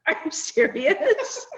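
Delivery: high, slow, laughing disbelief; comic retold "you can't mean it" tone; mock incredulity. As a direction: stretch the question upward in amused disbelief. Retells her incredulous inner reaction.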